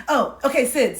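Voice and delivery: normal talking voice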